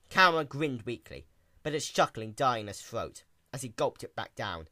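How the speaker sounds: ordinary delivery, nothing marked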